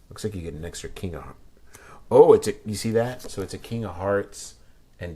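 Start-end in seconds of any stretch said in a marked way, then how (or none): 0.1-1.4 hushed unvoiced aside to self; soft breathy whisper. Real, not acted. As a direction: whisper it as you peek at the cards. Quiet aside while opening deck.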